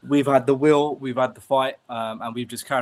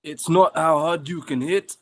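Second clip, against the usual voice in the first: louder, higher Balboa attempt landing on Schwarzenegger; botched Balboa accent; 6 semitones higher, 6 dB louder than usual. Told to say the film line in Rocky's accent, botched Balboa impression. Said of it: "That's Arnold Schwarzenegger, isn't it?".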